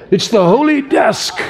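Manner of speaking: high-pitched mock preacher accent; put-on old-time preacher's accent; Spurgeon accent. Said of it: "That's my best Spurgeon accent".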